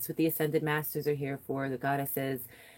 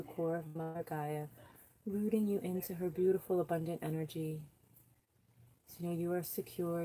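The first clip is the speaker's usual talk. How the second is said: soft, hushed meditation voice; soft guided meditation voice; 9 dB quieter than usual. Acted. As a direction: ease them gently through the grounding.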